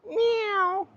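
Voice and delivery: tiny high-pitched kitten mew; kitten meow. Acted. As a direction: mew like a little kitten at the door. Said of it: "it was this".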